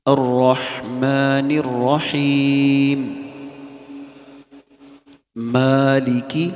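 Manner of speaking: slow chanted Quran recitation. Shown as a demonstration. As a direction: chant each word slowly. (آہستہ ترتیل سے تلاوت)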